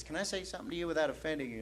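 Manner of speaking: soft, fast run-through of the quote; quick quiet retelling. Retold or imitated. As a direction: toss the question off quickly and softly.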